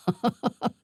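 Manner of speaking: a rising, delighted laugh; amused laugh. Acted. A dark elf laughing at the city's prodigal son. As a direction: laugh at the perfect satire. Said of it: "Who could help but laugh?".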